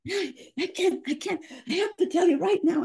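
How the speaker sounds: high, eager parent bursting to tell; excited as a child with news; like children. Retold or imitated. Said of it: "they'll come just like children".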